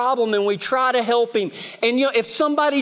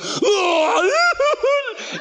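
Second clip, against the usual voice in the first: hammed-up sob-scream; 11 semitones higher than usual. Telling the congregation how he wails when he throws up, hammed-up sob-scream. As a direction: ham up a crying scream like a kid being sick. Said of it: "I cry, I bawl, I scream like a girl".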